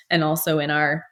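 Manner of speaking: burp while talking; words broken by a burp. Real, not acted.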